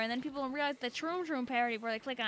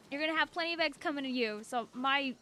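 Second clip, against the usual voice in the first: ordinary delivery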